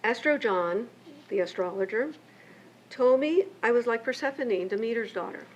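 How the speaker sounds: ordinary talk, nothing marked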